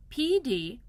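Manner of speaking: plain reading voice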